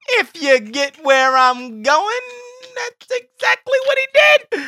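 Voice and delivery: very high, loud comic voice; milk the innuendo in a squeaky-high shout; hammed-up high-pitched nudge-wink; exaggerated innuendo. Comic innuendo, laughing co-host.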